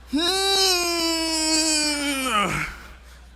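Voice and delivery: long, high strained wail, falling at the end; straining to use the Force. Acted. Dennis trying to lift his dropped books, long strained cry. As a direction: strain aloud as if moving objects by willpower. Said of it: "trying to use the force".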